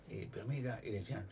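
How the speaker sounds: ordinary delivery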